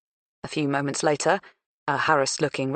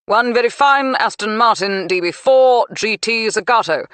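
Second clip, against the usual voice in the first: auctioneer announcing; 6 semitones higher, 8 dB louder than usual. A narrator's reading. Auctioneer presents star car.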